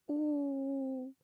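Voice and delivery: a sudden high, loud wail of despair; mock howl. A narrator's reading. Heroine howling at her own foolish contract, hammed-up wail. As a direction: howl it out like a wolf at the moon, hammed-up. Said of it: "завыла я".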